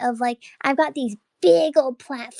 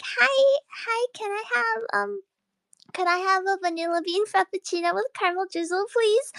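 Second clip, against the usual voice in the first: high ordering voice; 5 semitones higher than usual. Retold or imitated. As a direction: let the voice float up high. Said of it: "I try to force my voice deep, but I just was not paying attention".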